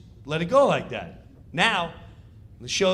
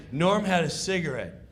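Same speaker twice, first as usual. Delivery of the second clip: usual speaking voice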